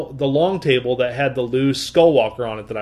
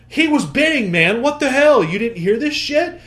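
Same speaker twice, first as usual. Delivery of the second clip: higher, put-out protest; indignant complaint; 8 semitones higher than usual. Retold or imitated. Dealer to his partner after losing, higher indignant gripe. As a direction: imitate a dealer griping about the bid. Retold angry toy dealer.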